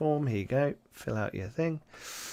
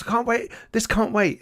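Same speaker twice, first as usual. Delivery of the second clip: urgent panic; 12 semitones higher than usual. Acted. Panicked father bursts in.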